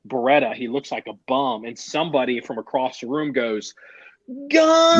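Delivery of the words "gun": high, loud yell of alarm; mock scream. Cop warning the room about a gun, loud high yell. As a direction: shout it across the squad room. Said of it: "they all have to scream".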